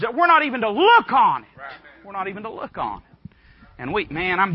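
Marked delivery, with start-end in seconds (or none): 0.0-1.5 loud, high-pitched insistence; emphatic preaching. Real, not acted. Preacher to congregation on what the Bible says, emphatic and loud. Preacher driving the point home. 2.0-3.0 softer, quicker, sober restatement; quiet repeat. Real, not acted. Preacher lets the Bible's point sink in, quietly repeated.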